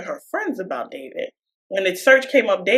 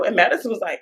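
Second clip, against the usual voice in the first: ordinary delivery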